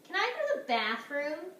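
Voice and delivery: lightly acted kid's request; child asking permission. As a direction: pipe up like a schoolboy asking. Boy asks teacher in story.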